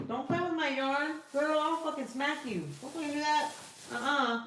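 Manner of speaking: high, mock-stern telling off; scolding the cat. Real, not acted. To Luna messing with her yarn, mock-stern scolding. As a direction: warn the cat off the yarn, half playful.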